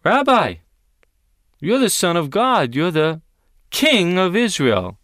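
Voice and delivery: loud, high awed declaration as Nathanael; voicing Nathanael. Nathanael answering Jesus, loud and awed. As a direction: cry it out in wonder. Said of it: "You respond by saying".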